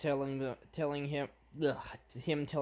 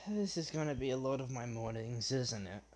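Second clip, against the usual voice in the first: low, soft resigned sigh; sighing inner thought; 4 semitones lower, 7 dB quieter than usual. Acted. Izuku sighing in his head at waking, low and resigned. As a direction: think it to yourself, low and quiet. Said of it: "Sighing, like, in his head".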